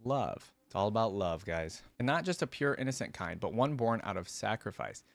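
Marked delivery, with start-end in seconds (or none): none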